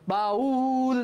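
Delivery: "বাউল" with a long held উ; stretched vowel. Shown as a demonstration. Teacher showing the vowel can stretch, "বাউল" drawn out. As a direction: pull out the উ in "বাউল" steadily. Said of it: "আমি রস্বউটাকে টানতে পারতেছি".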